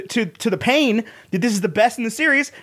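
ordinary delivery

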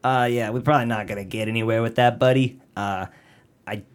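lightly put-on Boston accent, a cop brushing off a victim; a mild hint of a Boston cop drawling it off. Cops telling rich victim no luck, light Boston accent. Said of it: "sound like Boston cops".

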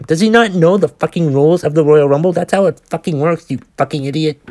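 swearing in open disgust; angry rant. Real, not acted. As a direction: rage at him like he can hear you. Ranting at Hogan in memory.

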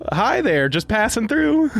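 casual passerby voice. Acted. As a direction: act like you're just strolling past. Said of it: "Will's mad with his voices today".

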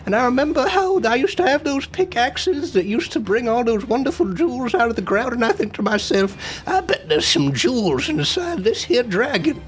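put-on reminiscing old gem digger; old prospector. Player speaks as old prospector.

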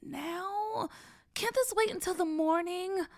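high-pitched, drawn-out protest; reluctant whine. Acted. Brother in bed putting off his sister, reluctant whine.

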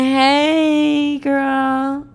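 long, high, droning hello; stoned awkward greeting. Retold or imitated. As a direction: imitate a dazed stoner stretching out the word. Repeats her stoned, awkward reply.

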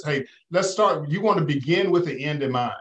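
ordinary talk

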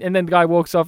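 usual speaking voice

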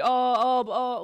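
hesitant stalling. Retold or imitated. Friends asked to post a video, mock hesitant stalling. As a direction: imitate a friend stalling without an answer. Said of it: "بيحكوا لي".

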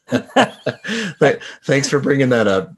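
real laughter. Real, not acted. Game master teased by players, laughing. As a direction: thank them while cracking up.

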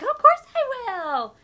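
loud, high, slow eager reply; eager yes. Retold or imitated. Mom eagerly agreeing to shave daughter's legs. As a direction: jump at the chance, drawn out. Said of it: "And I'm like".

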